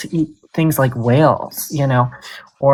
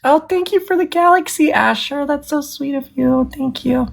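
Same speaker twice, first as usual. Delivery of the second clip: much higher, gushing gratitude; sweet gushing thanks; 10 semitones higher than usual. Real, not acted. Streamer thanks Asher for the galaxy gift, high and gushing.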